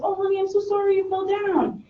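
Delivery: put-on high, sing-song apology to a hurt child; sympathetic parent. Parent apologizing to child who fell, high cooing. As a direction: coo an over-sorry honey. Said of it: "we have a tendency to apologize".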